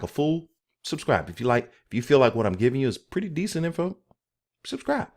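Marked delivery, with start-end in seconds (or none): 4.6-5.1 squeaky high plea. Acted. Repeated plea.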